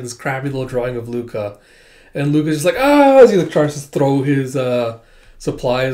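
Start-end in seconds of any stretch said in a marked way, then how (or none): 2.8-3.2 high, loud battle yell; a desperate cry as he throws. Acted. Throws bag at monster.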